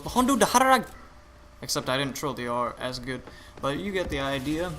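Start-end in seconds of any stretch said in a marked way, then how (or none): 0.0-0.9 loud, much higher foreign battle cry; militia NPC shout. Retold or imitated. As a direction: yell it like a game militiaman charging. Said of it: "where in the N P C goes".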